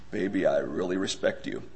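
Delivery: low, soft husband's card message; mock sweet talk. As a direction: drop low and quiet, as if wooing. Mock greeting card to wife.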